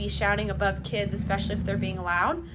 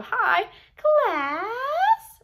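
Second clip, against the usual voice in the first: much higher, louder sing-song "Hi, class"; high silly voice; 11 semitones higher, 6 dB louder than usual. Shown as a demonstration. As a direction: greet them in a squeaky cartoon voice. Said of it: "different accents or different voices".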